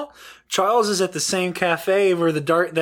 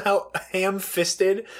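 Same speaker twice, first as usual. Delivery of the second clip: usual speaking voice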